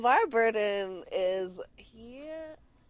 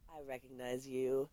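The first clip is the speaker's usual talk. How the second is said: hushed, lower robot recognition; mock robot; 4 semitones lower, 11 dB quieter than usual. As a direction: softly greet a secret fellow robot. Voices two men as secret robots.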